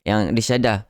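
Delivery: ordinary delivery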